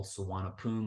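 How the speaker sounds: Suvarnabhumi as su-wah-na-POOM; Thai pronunciation with P. Shown as a demonstration. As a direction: make the B in Suvarnabhumi a P and drop the last vowel. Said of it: "with a P, not a B".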